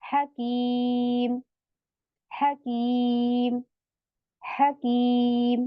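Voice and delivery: हकीम drawn out very slowly, ending on a silent म; slow chanted हकीम, stopped on the म; stilled meem. Shown as a demonstration. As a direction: recite हकीम slowly with no vowel after म. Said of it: "मीम को साकीन बना के रुकूंगी".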